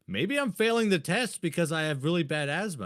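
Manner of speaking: retold frustrated outburst; exasperated protest. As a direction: argue back in a high voice. Upset protest to nurse in story.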